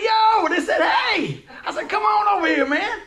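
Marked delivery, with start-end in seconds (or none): 0.0-1.4 high, loud street holler; calling out. Retold or imitated. Preacher re-enacting hailing guys in the neighborhood, calling out. 1.9-3.0 high, loud beckoning shout; calling over. Retold or imitated. Preacher re-enacting calling four guys over, calling out.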